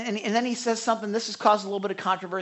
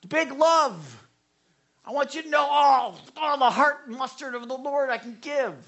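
gushing affection; 7 semitones higher than usual. Retold or imitated. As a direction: pour it on warmly. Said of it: "Or I'll just say".

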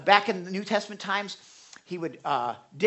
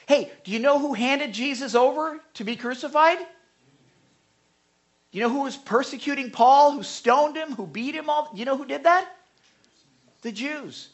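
rising challenge; 7 semitones higher, 4 dB louder than usual. Real, not acted. Rhetorical challenge to congregation.

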